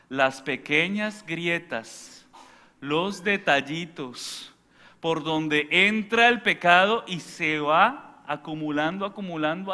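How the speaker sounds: weighty, drawn-out preaching; slow sermon build. Acted. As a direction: spell it out slowly for the flock.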